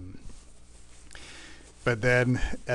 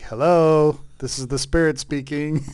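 higher, much louder mock stern Spirit; 5 semitones higher, 9 dB louder than usual. The Spirit scolding the speaker for ignoring it, a loud mock rebuke. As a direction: call him to attention like a heavenly wake-up call. Spirit kicks him, voiced loudly.

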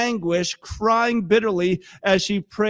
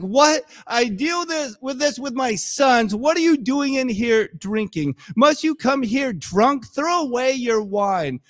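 raised-pitch, scolding disbelief; indignant priest; 4 semitones higher than usual. Acted. Priest accusing praying Hannah of drinking, acted indignant scolding. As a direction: play a fed-up priest telling her off. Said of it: "He's like".

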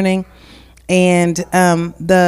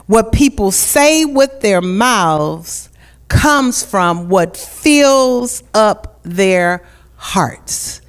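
raised, slow preaching cadence; 4 semitones higher, much slower than usual. Acted. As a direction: preach it from the pulpit. Scripture then slow preaching.

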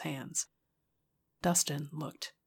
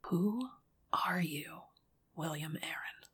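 whispered; 3 dB quieter than usual. Acted. Inner wondering whisper.

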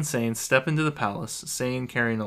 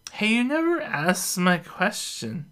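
tipsy pestering; 9 semitones higher, slower than usual. Acted.

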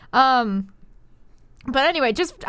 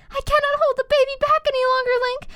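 pitched up, urgent mother-to-be; straining in labour; 8 semitones higher than usual. Acted. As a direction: tell your husband the baby is coming. Wife in labour voiced.

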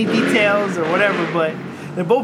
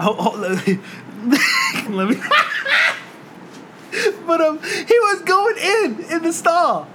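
high, slow, half-sobbing with laughter; can barely get it out for laughing; laughing through words; 10 semitones higher, slower than usual. Real, not acted. Telling his friend about the coworker in the stall, cracking up.

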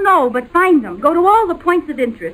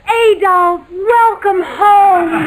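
hammed-up tearful welcome; 6 semitones higher, much slower than usual. Liz fakes a tearful reunion with her husband, hammed-up sobbing. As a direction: overplay the weeping reunion.

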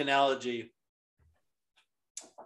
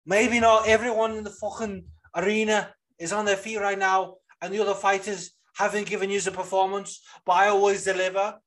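higher-pitched imitation of a Scouse accent; put-on Liverpool accent, pitched up; Molly McCann's Scouse; 5 semitones higher than usual. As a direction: do a Scouse fighter's post-fight speech. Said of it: "dude, when she was like".

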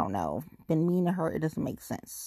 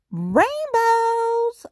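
much higher and louder, held out long; excited reveal; 5 dB louder than usual. Real, not acted. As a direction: sing out the big reveal. Dramatic reveal of rainbows.

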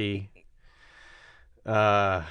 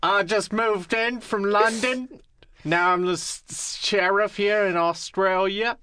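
higher, slow mock Cockney sheriff; 7 semitones higher than usual. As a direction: play a London sheriff in Australia. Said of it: "He's fucking Cockney".

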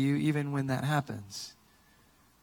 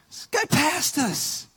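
high, drawn-out mock indignation; fuming, retold; 12 semitones higher, much slower than usual. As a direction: stretch it out in wounded disbelief. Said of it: "like, fuming".

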